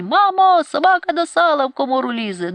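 put-on boy yelling the dog alarm to his mother; frantic shout. A narrator's reading. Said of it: "гукає, як несамовитий".

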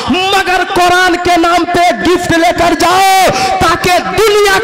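preacher urging listeners to donate for Quran teaching, fiery; fiery donation appeal. Acted. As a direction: rouse the congregation to stand and give. Fundraising appeal at full force.

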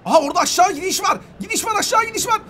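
high, animated call-out; excited discovery. Real, not acted. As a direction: climb in pitch as you spot it. Spots a way down.

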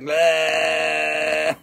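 playful tongue-out taunt; cartoon bleh at the viewer; mock tongue-out bleh. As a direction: blow a silly raspberry bleh. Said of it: "stick its tongue out at you and be like".

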